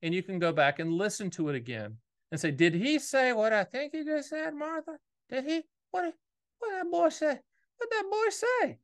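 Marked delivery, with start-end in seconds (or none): 2.5-6.1 put-on high, puzzled old-timer voice asking Martha; mock bewildered listener; confused listener. Viewer turning to Martha, baffled by fast talk. 6.6-7.4 put-on high, baffled old-timer asking; imitating a bewildered viewer; mock confused listener. Viewer puzzled by the fast talker. 7.8-8.8 put-on high, baffled old-timer asking; imitating a bewildered viewer; mock confused listener. Viewer puzzled by the fast talker.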